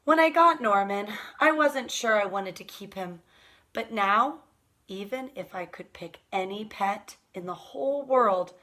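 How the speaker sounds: ordinary delivery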